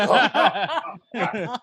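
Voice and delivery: mock flustered outburst, high and loud; nervous. Caller panicking as a woman picks up, mock nervous. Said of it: "I get nervous".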